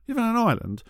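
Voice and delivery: high-pitched baffled questioning; mock incredulous. Outsiders asking about living on an island, mock incredulous.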